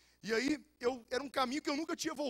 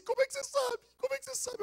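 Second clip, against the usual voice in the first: put-on much higher, amazed girl's voice; astonished girl. Girl stunned the stranger knew her pain, high amazed voice. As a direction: squeak it out in disbelief. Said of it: "ela falou assim".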